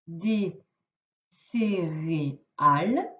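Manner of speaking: mots détachés, très lentement; lecture lente de maîtresse. Shown as a demonstration. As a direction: lis chaque mot pour que l'enfant suive. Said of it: "on les lit ensemble". (lecture lente et articulée, mot par mot)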